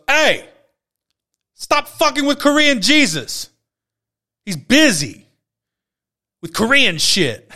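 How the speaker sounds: high, loud, slow imitated yell; yelled out the window. Angry captain yelling from his office window at the kneeling cop. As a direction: bellow it down at them like an irate captain. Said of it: "he goes".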